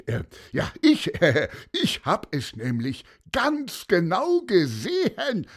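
high, loud, hectoring insults; high-pitched indignant ranting with a scoff; blustering accusation. A narrator's reading. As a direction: rail at the boy, stumbling over words. Seller insults accused boy.

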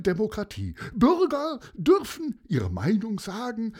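mayor addressing the crowd, pompous and long-winded; mayor's public speech. Acted. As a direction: orate from the dike. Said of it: "und rief".

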